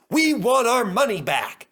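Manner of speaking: loud, high demanding cry; a crowd fed up and demanding; angry complaint. Acted. Passengers at the ticket office, loud and angry. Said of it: "they complained".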